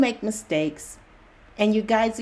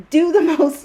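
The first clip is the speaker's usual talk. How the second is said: laughing exclamation; 8 semitones higher, 8 dB louder than usual. Real, not acted. Laughing about getting into trouble.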